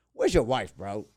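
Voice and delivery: imitated star demanding loudly; mock celebrity's booming demand; Anthony Anderson. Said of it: "he goes".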